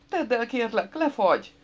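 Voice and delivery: high, urgent begging; pleading. A narrator's reading. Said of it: "rimánkodott". (magas hangú rimánkodás)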